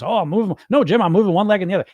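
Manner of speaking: a hint of a flustered know-it-all; mock self-quote. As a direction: play your younger self, brushing the question off fast. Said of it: "I was".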